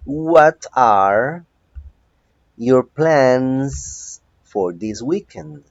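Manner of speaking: very slow, loud model question. Shown as a demonstration. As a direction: stretch it out for the class. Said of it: "slow model question". (pregunta modelo muy lenta)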